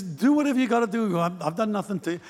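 offhand, slightly softened brush-off; dismissive. Acted. Elijah to the newly anointed Elisha running after him, dismissive. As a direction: wave him away casually. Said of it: "he's so dismissive of this poor guy".

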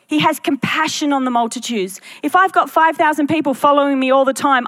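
ordinary talk

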